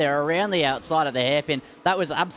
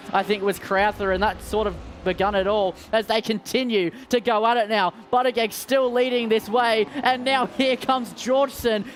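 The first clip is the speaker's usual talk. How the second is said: raised, excited play-by-play; motorsport commentator; 5 semitones higher than usual. Acted. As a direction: call the action as it happens. Live race call.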